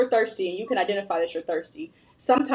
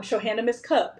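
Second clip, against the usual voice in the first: ordinary talk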